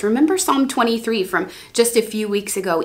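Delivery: ordinary talk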